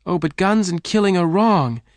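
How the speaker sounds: high, loud pleading; crying out. A narrator's reading. Jane pleading with Lassiter, crying out. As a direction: plead against the killing. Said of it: "Jane cried".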